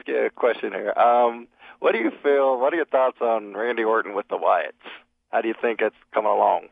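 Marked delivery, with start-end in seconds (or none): none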